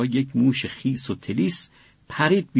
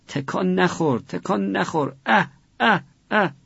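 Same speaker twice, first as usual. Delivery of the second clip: high, tearful pleading that breaks into sobs; crying; 7 semitones higher than usual. A narrator's reading. Father, weeping while soldering, begs his son to hold still. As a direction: plead through tears, voice cracking into high sobbing cries.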